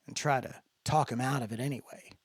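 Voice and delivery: usual speaking voice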